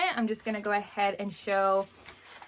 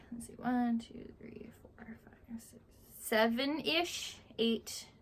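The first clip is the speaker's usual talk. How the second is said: soft murmured count, trailing to a whisper; counting under breath; 2 semitones higher, 7 dB quieter, slower than usual. Real, not acted. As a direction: count the books quietly to yourself. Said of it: "counting books under breath".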